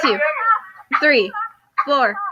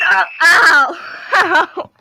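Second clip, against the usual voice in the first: loud cry of pain at a blast of sound; pained yelp; 4 semitones higher, 6 dB louder, much slower than usual. Real, not acted. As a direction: yelp like your ears just got hit. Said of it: "My ears".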